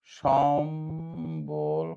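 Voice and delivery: সম্বল drawn out very slowly on the first syllable; option sounded out. Shown as a demonstration. Teacher testing an option for students, very slow. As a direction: stretch সম্বল with a long held m. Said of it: "নিচের কোনটি সঠিক নয়?".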